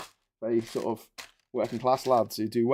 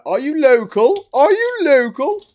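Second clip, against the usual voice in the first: high, loud, drawn-out character catchphrase, climbing higher on the repeat; comic character voice; 13 semitones higher, 12 dB louder than usual. Acted. Local challenges a stranger, high comic character voice. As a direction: screech it slowly like a suspicious grotesque local. League of Gentlemen catchphrase performed.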